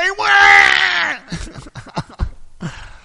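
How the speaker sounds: loud, high exaggerated bawl; mock crying wail. Imitating the crying housemate, long mock wail. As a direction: break into a hammed-up "waah". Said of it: "He's crying because".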